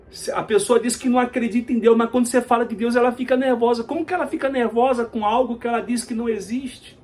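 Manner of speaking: fast, high-pitched rhetorical questioning; incredulous preaching. Real, not acted. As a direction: press the absurdity home to the congregation.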